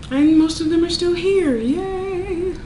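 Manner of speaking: high, delighted cheer; excited. Real, not acted. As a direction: squeal it happily. Delighted about surviving plants.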